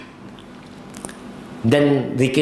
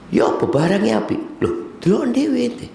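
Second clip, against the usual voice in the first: evasive trader; 11 semitones higher than usual. Retold or imitated. Trader dodging buyer's question.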